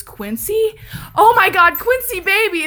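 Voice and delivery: high-pitched, loud distraught calling; imitated distressed cry. Officer finding her cousin shot, imitated anguish. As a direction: act out a cousin's horrified recognition. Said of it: "she's crying".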